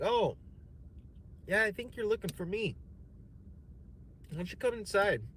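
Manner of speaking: high, friendly put-on host voice; imitated host. Rich host inviting the visitor in, high and friendly. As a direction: lure him inside sweetly.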